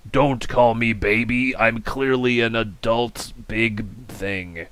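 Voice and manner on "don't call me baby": ordinary talk, nothing marked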